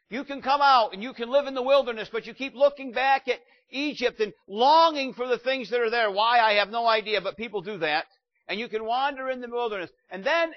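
loud, high, driving preacher voice; full-force preaching. Acted. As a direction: hammer each battle and victory home to the church.

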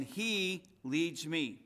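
"he" stressed and set apart, the phrase very slow. Shown as a demonstration. Preacher showing the psalm's emphasis is on the shepherd, "he" stressed. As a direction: lean on "he", then slowly give "leads me". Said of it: "It's always on the shepherd".

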